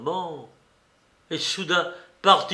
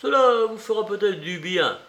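higher, eager, concerned voice; solicitous urging; 8 semitones higher, 6 dB louder than usual. A narrator's reading. Investigator hands over water to calm him, eager and solicitous. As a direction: coax him anxiously.

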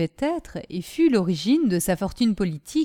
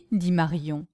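plain reading voice

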